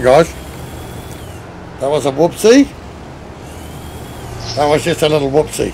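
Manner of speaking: normal talking voice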